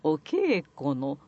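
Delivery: 「お稽古の」 slow, rising on the vowel then falling; pitch demo. Shown as a demonstration. Teaching an actor the accent of 「お稽古の」, slow. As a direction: shape a hill on 「お稽古の」, slowly. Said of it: "母音のところで山作って降りてきてください".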